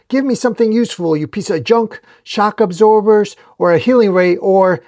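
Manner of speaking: high, loud demand; exasperated yell. A narrator's reading. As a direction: yell at a useless gadget. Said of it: "yelling at the jackpot device".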